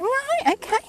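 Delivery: very high, drawn-up imitation of someone unconvinced; high-pitched mock acceptance; retold wary acknowledgement. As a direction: mimic a polite, doubtful response.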